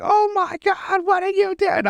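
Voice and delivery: very high, drawn-out imitated cry; horrified mock wail. Voicing Timmy bleeding in the corner, horrified mock wail. Acting out Timmy hurt and helpless.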